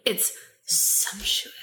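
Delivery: breathy seductive whisper; sultry whisper. Pretend. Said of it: "the sultry, sexy voice".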